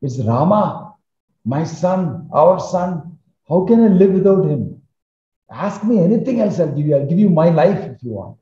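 begging for his son's sake; desperate begging. Acted. Fallen king begging Kaikeyi to spare Rama, desperate and rising. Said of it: "Dashrath will fall down and say".

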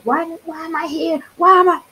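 high, rising exasperated wail; frustrated cry. Retold or imitated. Retelling her frustration, a high exasperated wail. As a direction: throw the question up in despair. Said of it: "I was so frustrated".

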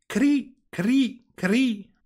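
very high chirping cri cri; cricket chirp. Acted. The cricket announcing itself to Pinocchio, high chirping. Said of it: "qualcuno che fece".

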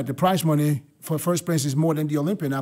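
ordinary delivery

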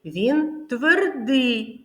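slow, firm твердий, each sound drawn out; teacher's slow emphatic твердий; stressed твердий. Shown as a demonstration. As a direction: lean on твердий, slowly. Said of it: "А який він?".

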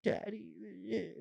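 high, soft, drawn-out whine; whining child. Retold or imitated. Dad demonstrating his daughter's whining tone, high and soft. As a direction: imitate a kid whining for a parent. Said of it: "that tone".

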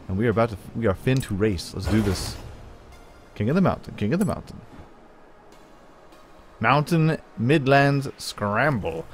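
ordinary delivery

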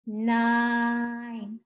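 "Nine" stretched out evenly; slow. Shown as a demonstration. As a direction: sound out "Nine", holding the long I. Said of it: "Say it slow".

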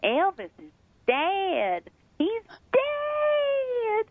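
slow, high, wailing announcement that breaks into crying; loudspeaker wail. Retold or imitated. Store clerk tells shoppers [name] died, wailing and crying. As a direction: drag it out like a grieving store announcer. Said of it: "the woman comes on the loudspeaker and she goes".

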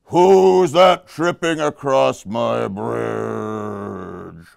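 very slow, drawn-out troll call; menacing troll. Acted. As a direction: draw out each word as a threatening troll. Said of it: "he heard that voice".

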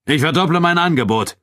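loud, high showman's announcement; Abu Dun. A narrator's reading. Nubian fighter raises his wager publicly, proclaiming. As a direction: proclaim the offer for all to hear. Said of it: "sagte Abu Dun".

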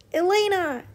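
high, loud panicked shout of a name. Acted. Eli shouting for his choking sister. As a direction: call out to her in alarm. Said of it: "Eli shouted".

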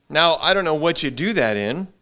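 ordinary talk, nothing marked

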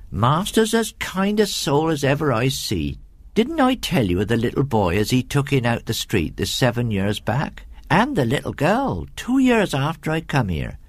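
rustic servant woman, gossiping; comfortable housekeeper's dialect voice; chatty country housekeeper. Acted. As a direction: lilt through it like a homely storyteller.

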